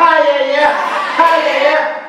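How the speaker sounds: high, punchy yeah yeah; hammed-up catchphrase; Sérgio Malandro cheer. Shown as a demonstration. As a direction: cheer it like a kids' TV host. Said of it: "Esse é o Sérgio Malandro".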